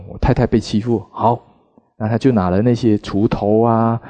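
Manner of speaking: ordinary talk, nothing marked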